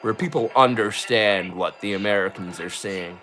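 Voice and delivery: put-on easy general American accent. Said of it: "normal American".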